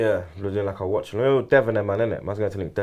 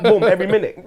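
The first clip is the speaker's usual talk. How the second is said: usual speaking voice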